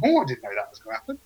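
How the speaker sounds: high, quick amazed exclamation; surprised. Acted. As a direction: gasp it out in delighted surprise.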